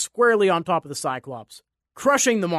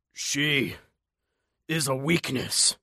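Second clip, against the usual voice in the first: dying gasp, words squeezed out; 3 semitones lower, slower than usual. Acted. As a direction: gasp it with last breath. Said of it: "the monster gasped".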